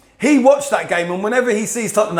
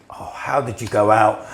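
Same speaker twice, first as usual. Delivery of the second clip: low, slow commiseration, softened; mock grieving; 9 semitones lower, 4 dB quieter, slower than usual. Postman knocking to grieve with a fellow fan, mock-mournful. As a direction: imitate a postman lamenting the defeat.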